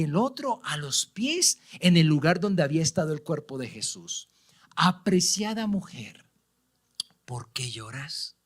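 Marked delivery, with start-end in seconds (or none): 4.7-8.4 tender whisper. Acted. Ángeles preguntan a María llorando.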